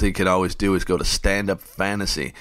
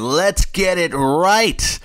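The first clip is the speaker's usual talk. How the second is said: excited pep talk; 9 semitones higher, 4 dB louder, much slower than usual. Acted. Hype pep talk to listeners.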